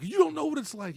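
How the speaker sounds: high, loud mock protest; retold outburst. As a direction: cry it out in imitated indignation. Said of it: "he's all like".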